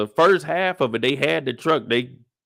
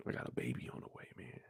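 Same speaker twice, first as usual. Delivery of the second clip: hushed whisper, almost no voice; soft whispered admission to his brother; whispered confession; 12 semitones lower, 22 dB quieter, faster than usual. Acted. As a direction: whisper it like a secret. Tommy confides his reason.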